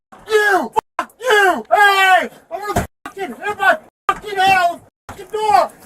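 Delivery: high, loud abusive yelling; real out-of-control angry shout; furious shouting. Real, not acted. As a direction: hurl it at the officer in a rage. Detainee raging at police.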